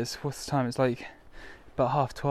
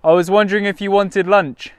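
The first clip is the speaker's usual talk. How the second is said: repeated loud, high call; calling from far away again; 7 semitones higher, 11 dB louder than usual. Real, not acted. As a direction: call it again so she hears. Repeats louder call unheard.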